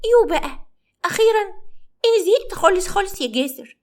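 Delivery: put-on high, whiny little-girl voice. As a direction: complain like an impatient small child. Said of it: "البنت رفعت وشها لفوق وقالت له".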